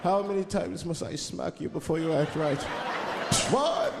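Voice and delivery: put-on slow vampire-pimp accent climbing into high cackles; the Count as a pimp. The Count scolding his worker, slow then high counting laughs. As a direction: count the smacks like a gloating vampire. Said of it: "They called him the Count".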